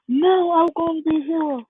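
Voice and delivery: put-on high, insistent boy's declaration; determined protest. Deku to his mother about being a hero, determined. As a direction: act out a child refusing to give up.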